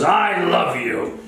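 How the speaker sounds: high, drawn-out holler; mock gushing declaration. Charlie declares love after a slobbering kiss, mock gushing declaration. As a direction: imitate an overbearing admirer, words stretched.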